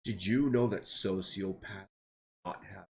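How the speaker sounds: low, deep creepy man voice; creepy boss. Retold or imitated. Retelling the creepy boss, low and deep. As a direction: drop way down like a creep. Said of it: "He said".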